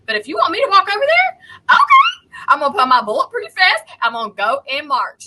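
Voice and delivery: very loud high-pitched mock outburst; heated defiant retort. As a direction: jump up high and loud, rushing into a challenge. Acts out defiant retort to sheriff.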